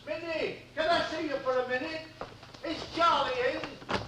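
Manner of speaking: high muffled call through a door; calling through a letterbox, hopeful; through a mail slot. Acted. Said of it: "shouting through the letterbox".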